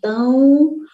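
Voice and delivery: normal talking voice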